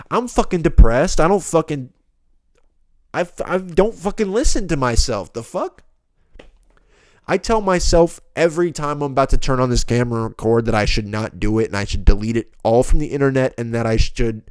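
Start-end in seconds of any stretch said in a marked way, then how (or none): 0.1-9.4 exasperated self-rant; heated rant. Real, not acted. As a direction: vent it, voice up. Ranting, raised voice.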